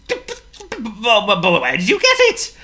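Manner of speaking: flustered stammer on but; stuttering. A narrator's reading. Shocked Hippo asks about the airplane, stuttering. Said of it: "stuttered Hippo".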